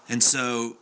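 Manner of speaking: ordinary talk, nothing marked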